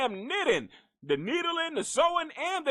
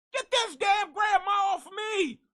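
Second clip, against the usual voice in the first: panicked yell; 11 semitones higher than usual. Pretend. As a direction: scream to get her off you. Victim yelling under grandma.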